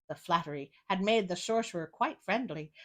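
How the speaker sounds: ordinary delivery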